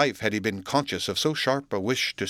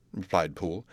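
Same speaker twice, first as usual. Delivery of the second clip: ordinary delivery, nothing marked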